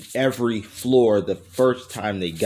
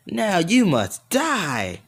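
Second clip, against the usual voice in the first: high, slow monster threat; hammed-up villain death sentence; Boo; 6 semitones higher, much slower than usual. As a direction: draw it out with swooping pitch. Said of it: "I'm Boo".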